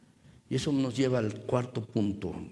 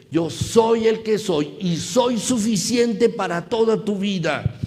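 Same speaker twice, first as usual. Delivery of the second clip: rising, louder preacher's cry; preaching at full force; 6 semitones higher, 9 dB louder than usual. Acted. Preacher urging brothers not to fear, full force. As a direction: preach it out to the congregation.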